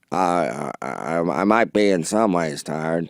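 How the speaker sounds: put-on low, stammering old-man voice; mock an old man, halting and low; old man impression. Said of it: "that old Negro that she's doing".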